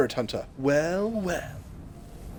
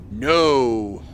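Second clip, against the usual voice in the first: 1 flat dubbed no; 4 dB louder than usual. Retold or imitated. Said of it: "he just goes".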